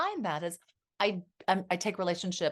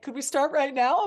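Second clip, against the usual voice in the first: put-on high, louder, hopeful young daughter's ask; eager daughter; 6 semitones higher, 6 dB louder than usual. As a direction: pipe up like a kid who finally gets her wish. Daughter's eager reply voiced.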